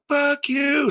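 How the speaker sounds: high-pitched retold outburst; high mock screech; mock angry yell. Irate customer ends the call.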